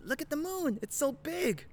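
imitating a very high little-kid voice; excited five-year-old. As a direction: marvel in a tiny, thrilled child's squeak. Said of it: "so excited when he sees the moon".

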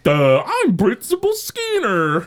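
high, slow dopey voice; dopey Skinner impression. Kid mocking the principal at the talent show, high and dopey. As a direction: play a kid doing a dumb Principal Skinner. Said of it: "boy of a thousand voices".

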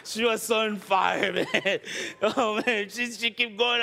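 high, laughing retelling; can't stop laughing as you tell it. Real, not acted.

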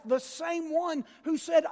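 ordinary talk

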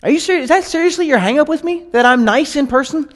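high, exasperated disbelief; incredulous Paul. Retold or imitated. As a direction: voice Paul scoffing at a silly complaint. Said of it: "But he says".

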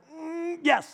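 high, sheepish reluctant mm yes; mock reluctant husband; grudging yes. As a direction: give in with a squeak. Reluctant agreement to wife.

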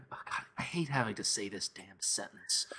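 soft exasperated whisper; whispered aside. Real, not acted. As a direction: mutter it under your breath. Dreads saying the sentence.

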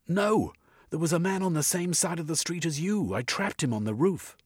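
high, urgent protest. A narrator's reading. Jeremy protests to Sergeant Koff about the real culprit. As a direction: insist on your innocence.

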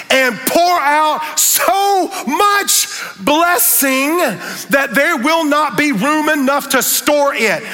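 high, slow shouted promise; preaching at full force. Acted. Pastor shouting the blessing verse to the congregation. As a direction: shout it like the sermon's peak.

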